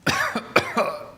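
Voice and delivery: choking cough; sputtering cough mid sip; choking on a drink. Real, not acted. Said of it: "Sorry, I'm choking".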